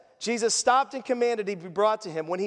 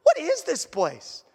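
put-on a young tourist stunned by the yachts and dolphins; amazed; 7 semitones higher than usual. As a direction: gasp it out in awe at the view. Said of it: "I'm like".

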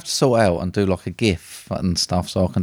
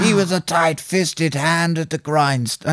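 higher, slow mock American accent; second stab at a bad American voice, pitched up; attempted American accent; 6 semitones higher, slower than usual. Host redoing his awful film impression, higher attempted American accent. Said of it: "supposed to be an American accent".

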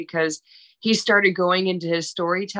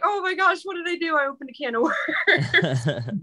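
much higher, half-laughing "oh my gosh"; panicked excited squeal; 11 semitones higher than usual. Retold or imitated. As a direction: squeal it out as if you just opened a can of worms. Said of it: "and I was just like".

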